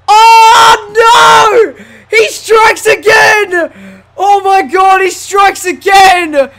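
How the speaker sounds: high, loud, wailing disbelief; dismayed shout. Real, not acted. Gamer reacting to Triple H striking again, dismayed shout. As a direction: cry out in horror at the game.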